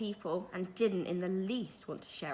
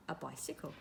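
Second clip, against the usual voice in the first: ordinary delivery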